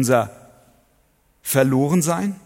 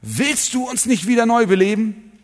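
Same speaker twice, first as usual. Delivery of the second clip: high, loud and full of hope; expectant plea; 9 semitones higher, 4 dB louder than usual. Shown as a demonstration. Preacher reads the verse to his congregation with confident expectation. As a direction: ask it as if the answer is already yes. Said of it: "in Erwartung gesprochen".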